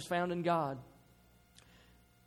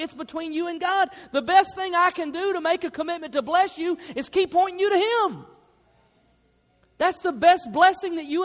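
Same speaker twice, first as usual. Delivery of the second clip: raised, loud preaching build; preaching at full force; 10 semitones higher, 7 dB louder than usual. Acted. Pastor exhorting the church, full preaching voice. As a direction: drive it home to the congregation.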